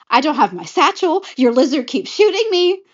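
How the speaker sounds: loud, high exasperated outburst; acted fed-up protest; frustrated. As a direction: complain in exasperation, pitch climbing. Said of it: "Flynn is all frustrated".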